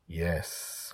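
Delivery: soft, hushed agreement; quiet, secretive assent; whispered. A narrator's reading. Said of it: "I whispered".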